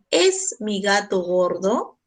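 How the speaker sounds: usual speaking voice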